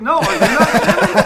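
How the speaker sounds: high, loud question through laughter; laughing astonishment. Retold or imitated. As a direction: throw it back loudly, cracking up. Retold astonished counter-question, breaking into laughter.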